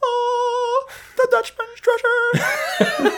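very high, slow, marvelling falsetto; awed mock voice. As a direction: gasp at it like found treasure. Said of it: "That was a good one".

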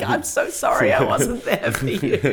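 imitated crying, breaking into laughter; badly faked weepy apology through giggles; mock tearful apology. Retelling a brother who started crying, mock tearful and laughing. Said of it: "he started crying".

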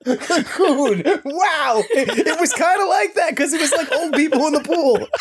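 high, laughing through the words. Real, not acted. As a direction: giggle as you speak. Laughing at porn joke.

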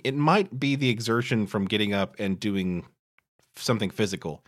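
usual speaking voice

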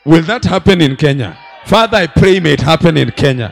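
loud laughing cry to God; shouted prayer. Real, not acted. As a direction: call it out to Father at full voice. Preacher cries out prayer for Kenya.

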